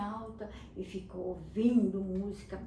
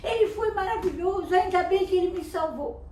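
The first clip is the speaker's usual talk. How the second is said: high, loud, delighted telling; rescued boy telling everyone, thrilled; excited bragging; 9 semitones higher, 5 dB louder than usual. Acted. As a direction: rave about him to everyone. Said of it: "começou a falar pra todo mundo".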